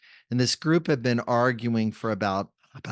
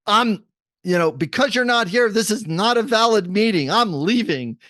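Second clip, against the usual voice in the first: higher and louder, a retold angry man storming out; angry outburst; 9 semitones higher, 5 dB louder than usual. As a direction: snap it at the mediator like an angry citizen. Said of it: "he was really mad".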